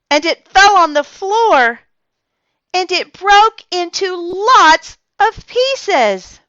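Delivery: put-on child telling grandma the glass broke; dramatic confession. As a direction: make the accident sound huge.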